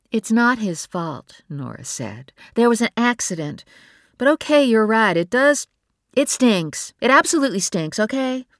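wife admitting it stinks; exasperated admission. A narrator's reading. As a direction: give in and let it out. Nora admits frustration.

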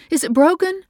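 high and loud, calling her husband in alarm; alarmed mother. A narrator's reading. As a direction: cry his name in fear.

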